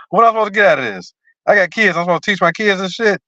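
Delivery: high-pitched, mock outrage, words tumbling out; acted indignant retort. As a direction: challenge them with rapid, disbelieving questions in an acted protest. Imagined angry retort over the book.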